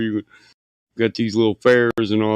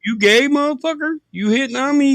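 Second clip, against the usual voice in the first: high, indignant retold yell; mock outraged yell; 12 semitones higher than usual. At a man licking his lips at him, indignant high yell. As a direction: hurl it at the guy on the porch, much higher. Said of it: "I said".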